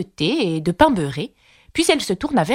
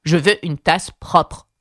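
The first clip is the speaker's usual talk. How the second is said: chapelier exigeant; slower than usual. A narrator's reading.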